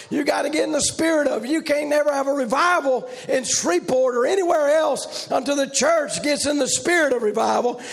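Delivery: rising, rhythmic preacher's cadence; preaching. Acted. Preacher to the church, preaching with rising force. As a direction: drive the point home to the congregation.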